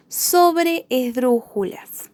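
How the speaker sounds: "Sobreesdrújulas" slow, split as sobre - esdrújulas; slowly separated. Shown as a demonstration. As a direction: draw out "Sobreesdrújulas" in its two parts. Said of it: "Cuando nos dicen sobreesdrújulas". ("Sobreesdrújulas" lento, separando sobre y esdrújulas)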